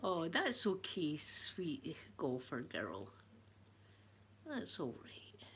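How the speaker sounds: slow, hushed comforting whisper; soft reassurance. Real, not acted. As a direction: soothe them gently, dropping to a whisper. Reassuring a viewer in the chat.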